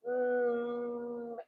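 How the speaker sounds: long high hum of estimation; lingering hum before committing; drawn-out mm. Real, not acted. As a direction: stretch out a doubtful mmm. Hesitating over how much is unsuitable.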